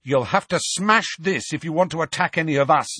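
lightly voiced brave teen; defiant boy. A narrator's reading. Harry warning Bellatrix, quick lightly voiced defiance. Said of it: "he told Bellatrix".

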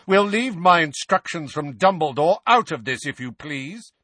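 forceful, firm cut-in; loud. A narrator's reading. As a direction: talk over them loudly. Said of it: "said Sirius loudly".

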